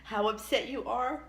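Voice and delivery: tearful, high and breaking voice; crying. Real, not acted. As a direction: choke up through the words. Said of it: "this could make me cry".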